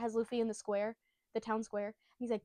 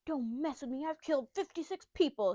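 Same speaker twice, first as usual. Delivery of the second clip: higher-pitched boastful bandit threat; mock tough bandit; 4 semitones higher than usual. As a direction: threaten like a puffed-up little bandit. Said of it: "and then he's like".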